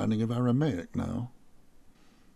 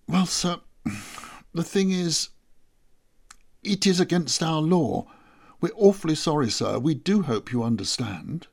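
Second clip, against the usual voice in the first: higher, humble, apologetic elder; 6 semitones higher, 3 dB louder than usual. Acted. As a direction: beg pardon nervously, eager to please. Elder apologetic about their law.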